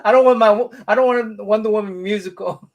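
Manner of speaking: laughing through a joking protest; joking protest through laughter. Real, not acted. Joking about Wonder Woman casting.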